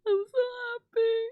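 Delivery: high, near-crying with stress on so; teary joy. Real, not acted. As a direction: tearfully lean on so. Repeated joy.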